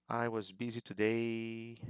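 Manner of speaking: TODAY held up high at the end. Shown as a demonstration. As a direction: keep the pitch up through "today", as learners do. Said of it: "they kind of raise the intonation a little bit".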